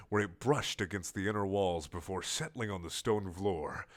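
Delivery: plain reading voice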